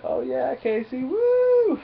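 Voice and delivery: high, slow drawn-out cheering woo; cheering whoop. Retold or imitated. The guys cheering Casey on, a drawn-out whoop.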